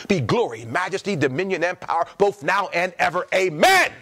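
low, hushed doxology. Acted. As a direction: recite it gently as a blessing. Reciting Jude doxology.

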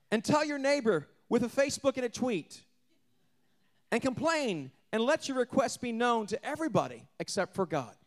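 twist the verse with a grin; mock misquote. Preacher mock-misquoting the verse to the congregation, high and sarcastic. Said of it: "No, that's not what it says".

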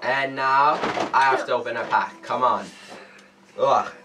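usual speaking voice

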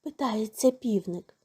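usual speaking voice

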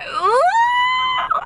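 a loud, very high squeal of delight; a high, touched "ooh" that bursts out; excited squeal. Retold or imitated. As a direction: squeal like the sweetest moment just hit your heart. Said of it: "I was like".

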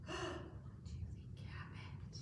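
soft, breath-held whisper; hushed suspense. A narrator's reading. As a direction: whisper the question to build suspense. Suspense question to listeners.

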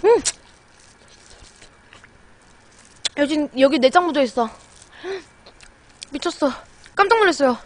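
chewing crab between words; speaking while biting crab; mouth full while talking. Real, not acted. Seen 1: bites crab shell at lips, chews, picks crab meat.

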